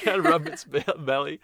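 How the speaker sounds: laughing through the words; giggling while agreeing. Real, not acted. Laughs at belly rub.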